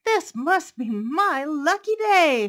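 high, loud, drawn-out exultant fox; shouting. A narrator's reading. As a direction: shout your good luck slowly and proudly. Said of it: "Fox shouted".

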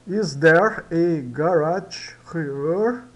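very slow, "Is there a garage here?" rising; slow review. Shown as a demonstration. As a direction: recap the sentence slowly for students. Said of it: "vamos recapitular".

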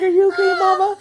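high worried dog voice; voicing the dog. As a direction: ask it like a worried puppy. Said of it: "Binks is like".

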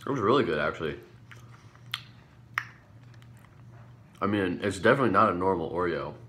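talking between bites; chewing while talking. Real, not acted. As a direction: mouth partly full. Seen 0: holds cookie, bites at 2.8 and 8.3, chews between.

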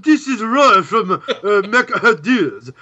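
high, loud, laughing mock voices of toy makers; mock phone callers. Friend mocks toy makers on a phone tree, high laughing voice. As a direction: squeak out silly company reps, giggling.

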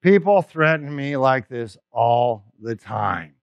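low, slow, unhurried reassurance; calm assured friend. Retold or imitated. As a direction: settle him down like a man with deep pockets. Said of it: "And he said to me".

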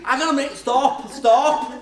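catching yourself mid-sentence, half-yelling; urgent inner alarm. Retold or imitated. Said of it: "And I'm thinking".

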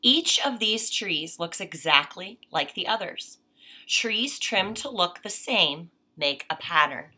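clear, measured read-aloud voice; reading to children. Acted. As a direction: read the page to a child, unhurried. Reads picture book aloud.

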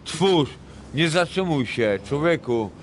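ordinary talk, nothing marked

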